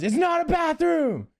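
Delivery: high, drawn-out mock scream; imitated scream. Homeless guy to people pushing his door, imitated screaming. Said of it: "the guy just starts screaming".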